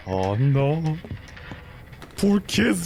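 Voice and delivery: ordinary delivery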